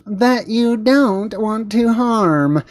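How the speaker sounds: mock-patronizing, high and drawn out; patronizing newsreader imitation. Host mimicking reporter talking down to viewers, patronizing. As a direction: mimic her slowly, as if talking to a child. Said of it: "talk to you like you're a child".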